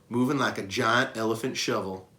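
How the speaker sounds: usual speaking voice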